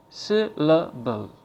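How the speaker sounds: slowly, syllable by syllable; slow syllable demo. Shown as a demonstration. Teacher slowly models the word syllable. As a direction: say it slowly, one beat per syllable. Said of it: "The word syllable, for example, has three syllables".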